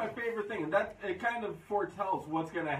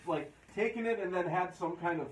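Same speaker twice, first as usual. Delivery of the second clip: ordinary delivery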